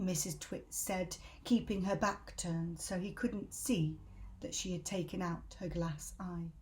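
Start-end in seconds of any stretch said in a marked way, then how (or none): none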